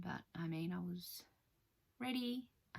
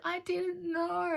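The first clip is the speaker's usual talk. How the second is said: very high, loud and slow pleading; squeaky protest; 11 semitones higher, 7 dB louder, much slower than usual. Retold or imitated. Patient protesting to the surgeon, very high and drawn out. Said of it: "And I was like".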